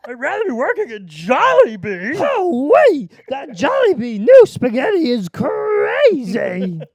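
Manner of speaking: high, loud, strained rasp; hammed-up cashier raving about food; squawking cashier. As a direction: crow about mango and Jollibee. Cashier voice continued.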